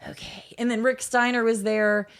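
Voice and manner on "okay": barely audible retold reply; quiet imitation. Wrestling idol answers nervous newcomer, imitated almost in a whisper. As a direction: imitate her, tiny and hushed.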